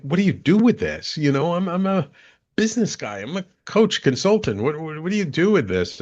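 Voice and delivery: high, pleading bewilderment, pitched well up; baffled plea. Retold or imitated. Asking his wife that night, bewildered and pitched high. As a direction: throw up your hands: what do I do with this?.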